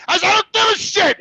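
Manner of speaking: high, loud outburst; retold angry yell; furious dismissal. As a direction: cut her off with an acted shout. Retold yelling at mother.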